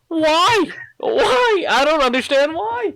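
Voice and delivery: high, loud cry of why; exasperated disbelief. Retold or imitated. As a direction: protest in shrill mock outrage. Mock outrage at complaint.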